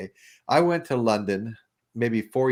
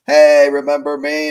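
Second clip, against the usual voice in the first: loud, slow, friendly call across the street; bartender's call; 4 semitones higher, 12 dB louder, slower than usual. Retold or imitated. Bartender greeting the tourists, loud and slow. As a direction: hail them like an old pal.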